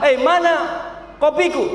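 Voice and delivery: high, demanding mock outburst; acted annoyance, pitch jumping up; angry husband. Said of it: "Ketika suami marah".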